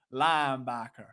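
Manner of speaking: deep, put-on Southern voice; low fake Southern drawl; Southern accent. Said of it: "do it with a southern ac".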